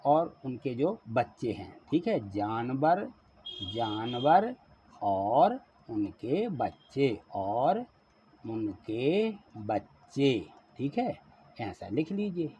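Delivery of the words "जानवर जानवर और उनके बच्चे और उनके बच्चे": drawn-out, low-pitched dictation; word by word, very slowly. Shown as a demonstration. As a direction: stretch each word so learners can write along. Teacher dictates words to be written.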